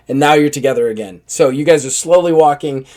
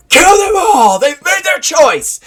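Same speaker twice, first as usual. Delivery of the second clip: much higher, loud furious order, slowing at the end; battle command; 4 dB louder, slower than usual. Acted. Paladin leader ordering his men to attack, furious. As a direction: order the slaughter. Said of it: "And then he goes".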